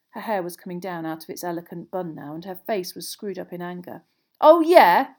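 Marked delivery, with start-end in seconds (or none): 4.4-5.2 very loud, high, challenging retort; angry yell back. A narrator's reading. Ron hurls it back at Hermione, angry yell. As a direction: yell it straight back in a row. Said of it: "Ron yelled back".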